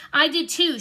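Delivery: belligerent. A narrator's reading. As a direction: snap back like a stubborn little sister. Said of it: "she said belligerently".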